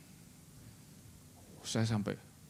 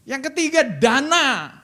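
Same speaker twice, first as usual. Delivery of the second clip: high, loud climactic shout; preaching shout; 13 semitones higher, 11 dB louder than usual. Acted. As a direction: hit the punchline full force. Preacher's punchline point.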